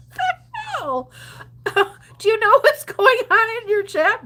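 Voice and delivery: high, laughing disbelief; incredulous viewer. Retold or imitated. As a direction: squeal at the chaos in chat, amused. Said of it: "You're like".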